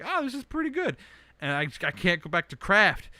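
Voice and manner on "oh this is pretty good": much higher, impressed self-quote; pleasantly surprised. Retold or imitated. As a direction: squeak it out, surprised at yourself. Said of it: "and I was like".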